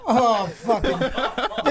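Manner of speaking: high, laughing flustered sputter; flustered self. Retold or imitated. Retelling his reply to the crowd, laughing sputter. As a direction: sputter helplessly through laughter. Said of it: "I'm like".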